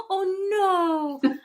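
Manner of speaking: rolled-R Natalie accent, high and loud with a mock cry. Retelling Jasmine on the show in a Natalie accent. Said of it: "was she doing a Natalie accent?".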